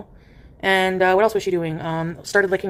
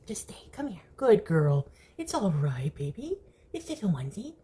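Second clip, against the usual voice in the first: quiet, tender coaxing; soft soothing; 8 dB quieter than usual. Real, not acted. Owner softly coaxing her cat to stay. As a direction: coax the kitten gently, softly.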